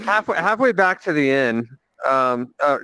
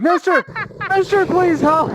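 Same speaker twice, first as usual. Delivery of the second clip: frantic woman. Acted.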